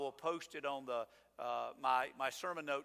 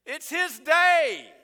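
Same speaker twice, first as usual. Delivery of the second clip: emphatic; 10 semitones higher, 14 dB louder, much slower than usual. Acted. Preacher hammers the point.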